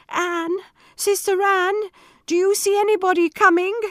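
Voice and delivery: ordinary delivery, nothing marked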